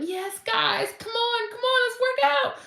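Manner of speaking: very high, bright hype-up into a headset; hyped fitness instructor. Pretend. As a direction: pump up the class like a cheerleading trainer. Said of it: "I have my little headset like".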